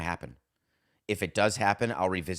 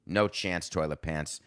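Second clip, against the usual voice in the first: ordinary talk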